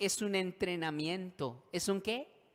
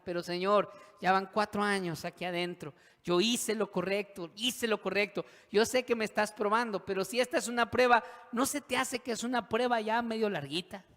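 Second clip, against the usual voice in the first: louder, building complaint to God; exasperated protest; 5 semitones higher, 5 dB louder than usual. Acted. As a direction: plead your case with rising exasperation. Acted protest of jailed José.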